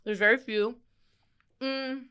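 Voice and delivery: talking with a mouth full; words round a bite of food. Real, not acted. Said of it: "I know you got a mouthful".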